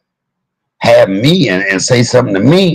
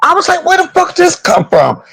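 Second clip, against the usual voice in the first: much higher, shocked squeal. Retold or imitated. Host retelling his shock at the panel, high squeal. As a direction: squeak it out in disbelief. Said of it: "I shut up 'cause I was shocked".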